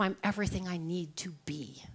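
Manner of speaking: soft unvoiced whisper; whispered affirmation. Speaker to listeners, whispering the affirmation. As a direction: breathe it out as a whisper.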